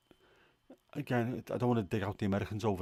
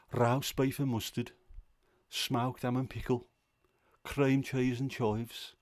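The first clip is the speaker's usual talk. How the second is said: slow, higher-pitched Brummie lilt; Brummie accent; 4 semitones higher, slower than usual. Shown as a demonstration. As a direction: list crisp flavours in slow, broad Brummie. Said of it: "sound better in a Brummie accent".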